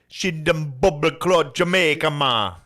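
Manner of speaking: put-on high, loud Jamaican accent. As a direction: slip into a Jamaican patois. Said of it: "Are we allowed to do Jamaican accent?".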